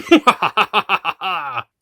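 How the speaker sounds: high, loud gloating cackle; theatrical master's evil laugh; evil villain laugh. Acted. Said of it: "the Master and Rani's evil laughs".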